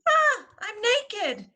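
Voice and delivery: high, slow wail of a crayon; high startled little wail; crayon crying out. Acted. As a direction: cry out high and dismayed. Crayon cries out.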